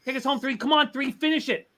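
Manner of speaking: high-pitched pleading cheer; yelling at a horse race. Real, not acted. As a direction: beg the horse to finish. Cheering his horse home.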